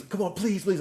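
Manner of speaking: high, eager mock begging; imitated pleading. Movie character begs his friend for permission, imitated pleading. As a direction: beg like an over-earnest friend. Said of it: "he wants so bad".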